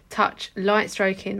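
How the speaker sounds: usual speaking voice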